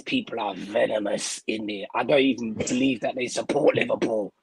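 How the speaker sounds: deep chest voice. Shown as a demonstration. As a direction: drop low into the chest like a growly rapper impression. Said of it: "that's my deep chest D M X voice".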